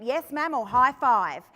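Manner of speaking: playful loud comeback. Retold or imitated. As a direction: turn the insult into a game. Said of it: "And I went".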